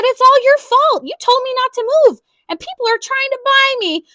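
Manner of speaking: high, loud whiny complaint; upset child. A narrator's reading. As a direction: blame Daddy through tears. Child blaming father, upset.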